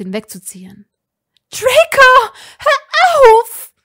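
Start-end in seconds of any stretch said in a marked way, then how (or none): 1.5-3.7 much higher, loud pleading cry; desperate shout. A narrator's reading. Hermine trying to pull Draco off Ron, screaming. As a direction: scream at him to stop.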